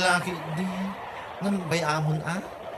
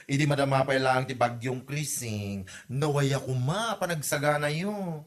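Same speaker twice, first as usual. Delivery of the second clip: ordinary talk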